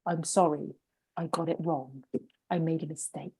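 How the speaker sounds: quiet, low-pitched admission of fault; softly spoken model apology. Acted. Models saying a sincere apology.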